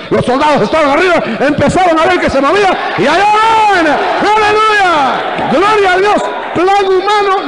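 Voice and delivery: very high, full-force pulpit cry; climactic preaching shout. Real, not acted. Preacher tells of the walls falling, exultant shouting. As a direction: build to an exultant scream of praise.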